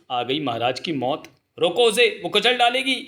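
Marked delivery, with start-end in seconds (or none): 1.6-3.0 high-pitched panicky cry; acted alarm. Warning that the elephant will trample, high alarmed cry. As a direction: cry out to stop the charge.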